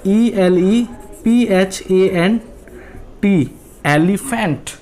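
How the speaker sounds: Elephant spelled out letter by letter, very slowly, then said whole; teacher spelling for students. Shown as a demonstration. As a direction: spell each letter deliberately, then land the word. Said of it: "ई को देखें".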